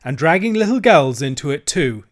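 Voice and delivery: scornful dealer. Acted. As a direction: sneer at the children. Dealer berates the children.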